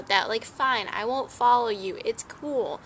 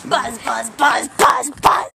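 buzzy housefly; 2 semitones higher, 11 dB louder, slower than usual. Pretend. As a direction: buzz it out like a frantic fly. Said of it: "This is my impression of a fly".

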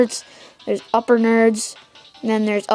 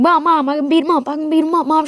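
higher, whiny begging to mom; pleading kid; 5 semitones higher, faster than usual. Retold or imitated. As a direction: beg mom to let you fight. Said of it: "I'm like".

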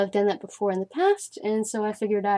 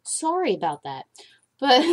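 usual speaking voice

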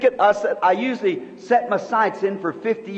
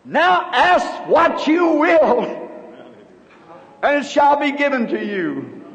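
voice from above; 4 semitones higher than usual. Retold or imitated. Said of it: "something said to me".

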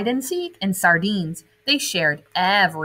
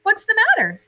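high, louder gentle ask; caring question; 4 dB louder, faster than usual. Acted. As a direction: reach out to the crying fish. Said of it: "Gilbert asked".

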